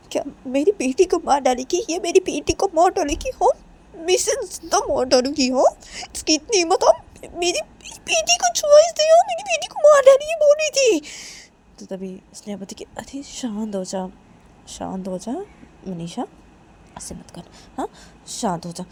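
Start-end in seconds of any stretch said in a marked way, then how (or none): 0.1-11.0 very high-pitched outraged rant, climbing to a shriek; enraged mother working up into a high squeal; acted fury. Mother raging over a threat to her daughter, furious high-pitched outburst. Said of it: "मुझे बहुत गुस्सा आ रहा है". 12.8-18.9 soft, hushed calming voice; soothing. Acted. Friend calming the furious Manisha, soft and soothing.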